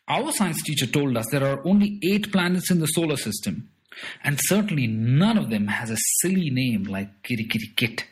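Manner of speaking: a schoolboy correcting a grown-up; young boy Zip Tucks. Acted. Boy corrects strange man about planets, lightly voiced boy.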